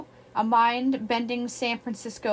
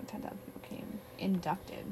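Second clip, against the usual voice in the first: whispering; 4 semitones lower, 15 dB quieter than usual. Real, not acted. Aside about a cult.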